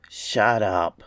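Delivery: low gruff beast command; grunted command. A narrator's reading. Creature in darkness silencing the ape man, low grunt. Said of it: "said the voice from the darkness and grunted".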